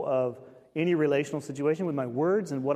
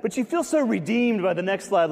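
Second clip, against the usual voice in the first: ordinary delivery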